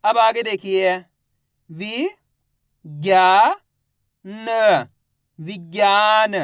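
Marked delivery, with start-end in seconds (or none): 1.7-6.3 direct syllable reading. Shown as a demonstration. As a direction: sound out विज्ञान piece by piece. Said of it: "direct मात्रा का उच्चारण करके पढ़ेंगे". (वि, ज्ञा, न अलग-अलग, फिर विज्ञान खींचकर)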